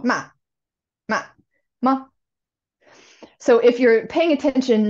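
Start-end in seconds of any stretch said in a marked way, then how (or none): 0.0-2.1 "ma" repeated, each with a distinct tone shape; Mandarin tones demo. Shown as a demonstration. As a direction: point and say each tone of "ma". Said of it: "I'll point to it as I say it".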